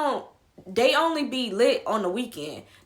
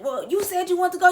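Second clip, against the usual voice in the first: high-pitched mock protest; protesting driver; 8 semitones higher than usual. Driver arguing with his passenger, protesting. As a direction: imitate him objecting, pitch raised.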